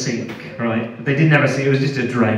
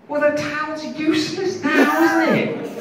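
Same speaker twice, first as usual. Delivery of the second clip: higher, sarcastic complaint; sarcastic retort; 14 semitones higher than usual. Retold or imitated. Comedian complaining to the interrogator, higher sarcastic tone. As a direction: point out the obvious with mock reason. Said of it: "And I'm like".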